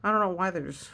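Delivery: usual speaking voice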